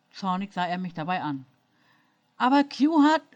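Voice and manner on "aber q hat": blurted high and loud, faltering; stammering out a protest, higher and louder. A narrator's reading. Jenny stammers her defence to angry Jean-Luc, high and loud. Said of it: "stammelte ich nur".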